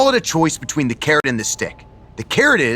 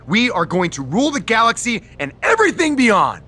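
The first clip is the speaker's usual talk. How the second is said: excited boast; 8 semitones higher than usual. Acted. Miz gloats over their new alliance.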